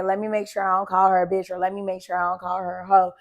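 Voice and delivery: ordinary talk, nothing marked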